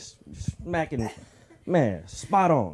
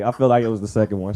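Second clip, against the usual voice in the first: normal talking voice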